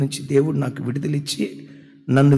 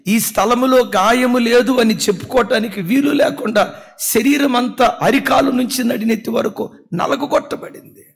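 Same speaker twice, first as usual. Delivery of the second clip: higher, louder fervent preaching; full-force preaching; 7 semitones higher, 6 dB louder than usual. Acted. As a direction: pour it out to the congregation with mounting force. (ఉద్రేకంతో బిగ్గరగా ప్రసంగం)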